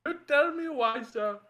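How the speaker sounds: mock Indian accent. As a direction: repeat the bit in a put-on Indian accent. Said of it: "not even ironically funny".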